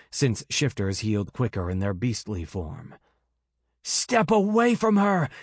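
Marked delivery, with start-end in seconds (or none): 3.8-5.3 much higher, louder furious bellow. Acted. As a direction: roar at him to back off. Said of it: "Bast bellowed".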